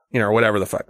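ordinary talk, nothing marked

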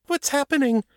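very high frightened question; fearful, octave up. A narrator's reading. Will asking as the trap triggers, voice high with fear. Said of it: "fear driving his voice up an octave".

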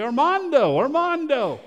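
shouting a lost son's name, high and loud; a father yelling for his son in the ruins; calling a name loudly. Retold or imitated. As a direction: call out desperately across the rubble. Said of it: "began to yell his name".

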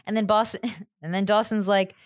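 ordinary talk, nothing marked

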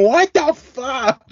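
high, slow mock disbelief; mock incredulous what-the-fuck, slow and high; imitating friend's outburst. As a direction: replay her stunned what-the-fuck for laughs. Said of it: "of you saying".

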